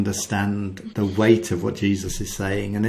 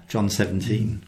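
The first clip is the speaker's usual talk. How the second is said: usual speaking voice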